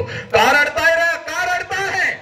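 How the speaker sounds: high, sustained acted shout; very high oratorical yell; father yelling. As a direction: cry out at the whole crowd. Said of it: "सगळ्यांना ओरडतो".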